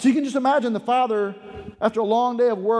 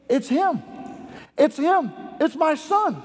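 excited recognition; 6 semitones higher than usual. Acted. Father recognizes his returning son.

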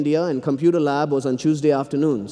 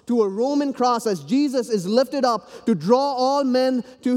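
higher, ringing sermon climax; preaching at full force; 7 semitones higher than usual. Acted. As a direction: lift the congregation to the cross. Climax of foot-washing sermon.

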